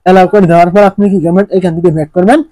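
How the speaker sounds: ordinary talk, nothing marked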